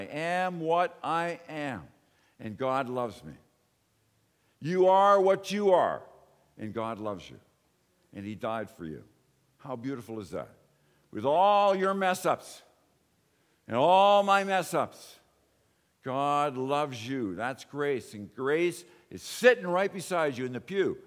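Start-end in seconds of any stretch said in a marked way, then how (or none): 4.6-6.0 preached declaration. Acted. 11.1-17.3 slow fervent preaching. Acted. Preaching at full force.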